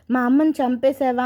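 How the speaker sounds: usual speaking voice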